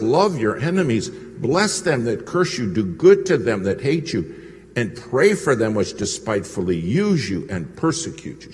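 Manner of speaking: proclaiming every line of the verse; preached verse. Retold or imitated. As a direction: thunder the verse out. Secretary quotes scripture.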